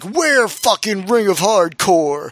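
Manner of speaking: drawn-out mock wrestler's yell. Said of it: "randomly yelling".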